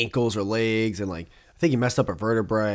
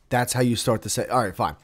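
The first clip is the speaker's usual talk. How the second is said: normal talking voice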